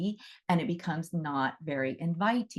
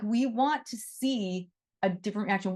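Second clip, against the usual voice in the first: ordinary talk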